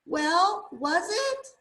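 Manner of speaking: high, loud, rising question; impatient demand. A narrator's reading. Rocket rushes to the tree for the answer, demanding. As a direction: press for an answer, voice climbing.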